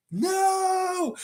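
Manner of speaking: long, high wail of dismay; mock anguished cry. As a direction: cry out in drawn-out, hammed-up horror. Raptor's jaw drops at pancakes.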